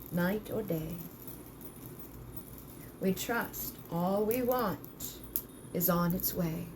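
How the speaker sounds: slow, solemn spell chant with long pauses; ritual incantation. Acted. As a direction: call on the powers slowly, like casting a spell. Leads a spoken ritual spell.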